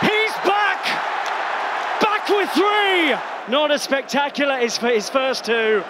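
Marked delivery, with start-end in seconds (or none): 0.1-3.2 high, ringing shout of joy; ecstatic goal call. Real, not acted. Commentator roaring Son's hat-trick, ecstatic. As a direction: belt out the hat-trick moment.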